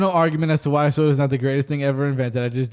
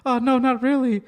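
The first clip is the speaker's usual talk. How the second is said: higher, gushing talk-show host pleading; Oprah impression; 8 semitones higher than usual. Host mimics Oprah pleading with her guru guest, higher voice. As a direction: beg the guru for wisdom, gushing and high. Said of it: "She goes".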